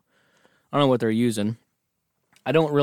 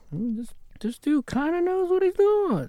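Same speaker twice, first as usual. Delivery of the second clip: high silly voice. Retold or imitated. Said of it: "his superiors there were like".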